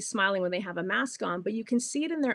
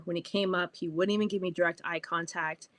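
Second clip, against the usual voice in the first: ordinary delivery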